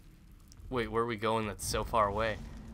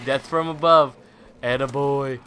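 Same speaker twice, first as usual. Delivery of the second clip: loud victory call; triumphant cheer; 2 semitones higher, 10 dB louder than usual. Real, not acted. Player celebrating a kill from above, triumphant cheer.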